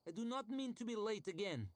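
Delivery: high, prim and fretful lady's voice; a pampered mistress fussing, pitched high; haughty noble lady. A narrator's reading.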